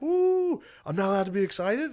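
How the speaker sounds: giddy squeal. Retold or imitated. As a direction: squeal it like an overexcited kid. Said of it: "he switches from being like".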